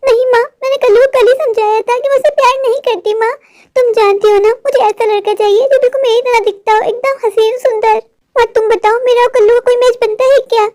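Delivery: put-on high girlish voice, hurt; a spoiled daughter let down by her mother; whiny daughter. Daughter Gudiya pleads.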